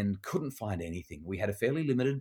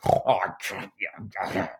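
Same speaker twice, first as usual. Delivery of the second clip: scoffing grumble; 3 dB louder, much slower than usual. Retold or imitated. Dad scoffs at seminar idea.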